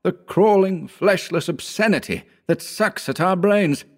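high, ranting cry of revulsion; feverish, high-pitched outburst; impassioned horror. A narrator's reading. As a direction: declaim the horror in a high, fervid voice. Howard's impassioned horror speech.

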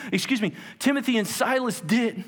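usual speaking voice

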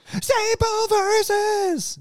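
slow, high, stretched announcer call. Pretend. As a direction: drag it out like a show host. Repeats segment name in announcer voice.

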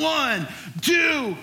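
high, drawn-out countdown call; pumped-up, high-pitched counting; excited countdown. Retold or imitated. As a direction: call out the big launch count. Retold big countdown before launch.

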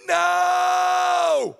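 put-on high, loud kids' shout of No; a long, high, loud shouted No. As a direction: yell it out like kids dodging the job. Kids refusing to pray.

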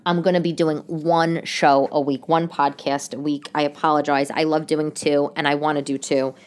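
ordinary talk, nothing marked

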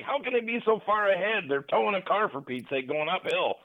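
high-pitched retold exasperation; freaked-out complaint. Lost driver wondering how they got ahead, exasperated. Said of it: "being so freaked out".

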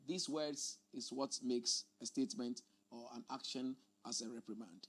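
normal talking voice

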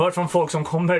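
lightly put-on Småland Swedish; a hint of Småland dialect. Shown as a demonstration. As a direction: slip into a mild Småland accent. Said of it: "då pratar man så här".

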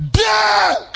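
sudden high shout of one word. Real, not acted. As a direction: bark it out to wake the church. Preacher shouting at congregation.

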